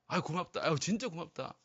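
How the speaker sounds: soft, hushed thanks, partly whispered; a moved father thanking his child softly; tender grateful dad. Retold or imitated. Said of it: "막 이렇게 하잖아요". (아이에게 속삭이듯 고마워하는 아빠)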